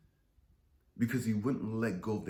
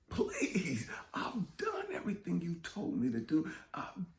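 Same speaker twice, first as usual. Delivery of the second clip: higher, breathless whispered begging; whispered plea; 8 semitones higher than usual. Retold or imitated.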